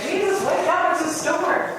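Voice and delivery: high mock alarm; mock panic. Disciples waking Jesus in the storm, high mock panic.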